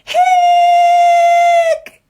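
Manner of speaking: very high, loud shrieked hic; screamed hiccup. Acted. Skeleton seeing the mirror, screamed high hic. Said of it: "Skeleton screamed".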